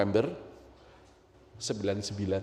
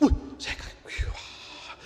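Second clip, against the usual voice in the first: whispered, breath-only awe; takjub; 5 dB quieter, slower than usual. Retold or imitated. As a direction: gasp out wonder almost without voice. Awed at seeing the birth. (bisikan kagum)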